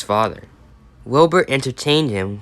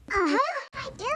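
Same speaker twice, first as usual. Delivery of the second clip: much higher cartoon girl voice; high squeaky reply; 8 dB quieter than usual. Miku answering Cameron in a high squeaky voice. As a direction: pipe up in a tiny high voice.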